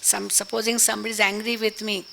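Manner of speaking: ordinary talk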